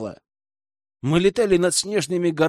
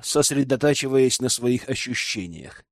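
plain reading voice

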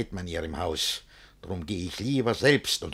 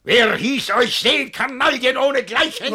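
much higher, louder furious scolding; raging tirade; 8 dB louder than usual. Acted. Master cursing the servants, high and loud fury. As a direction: tear into them.

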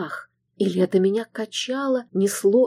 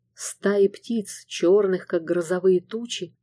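usual speaking voice

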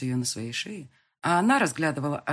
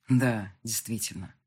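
low, slow, husky rasp; hoarse; 6 semitones lower, slower than usual. A narrator's reading. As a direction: rasp it out, drawn slowly. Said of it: "прохрипел Марат".

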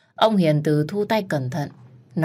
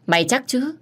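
challenging; 8 semitones higher than usual. Acted. Dần challenges Ngọc Ni.

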